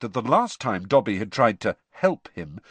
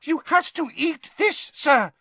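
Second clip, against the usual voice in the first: squeaky house elf. Acted. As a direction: squeak it out urgently. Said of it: "squeaked the elf".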